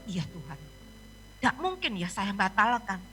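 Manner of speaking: low, soft, slowing self-talk; dejected private thought. Retold or imitated. Pastor thinking to God that cancelling is impossible, dejected. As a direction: think aloud quietly, weighed down.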